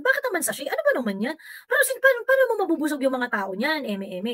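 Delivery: high, indignant mock whine from the supporters' side; mocking complainer. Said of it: "ganyan, ganyan". (mapang-uyam na mataas na boses na nagrereklamo)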